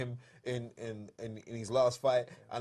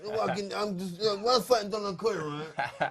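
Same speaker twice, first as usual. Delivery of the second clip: higher, louder mock boxer voice, laughing through it; Lloyd Hannigan impression; 7 semitones higher, 4 dB louder than usual. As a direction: lift the voice and laugh while mimicking the old fighter. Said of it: "I can do a very good Lloyd Hannigan".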